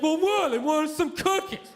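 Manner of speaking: slow, dopey mock defence; moron. Husband defending himself on the talk show, dopey moron voice. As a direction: play the dim guy justifying himself. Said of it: "always the biggest moron ever".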